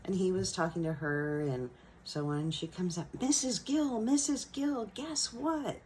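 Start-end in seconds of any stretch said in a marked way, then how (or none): 3.2-5.8 put-on high-pitched, happy little-girl call; mock happy squeal, much higher but not screamed; imitating excited girl. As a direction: act out a child bursting with good news. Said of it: "screech, you know, the happy screech".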